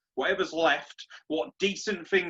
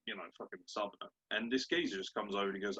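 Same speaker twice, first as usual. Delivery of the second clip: ordinary talk, nothing marked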